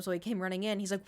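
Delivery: ordinary talk, nothing marked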